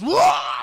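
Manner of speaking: sudden high, very loud roar; hammed-up attack yell; acted battle yell. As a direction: roar as if lunging with your last strength. Acting out John's last lunge.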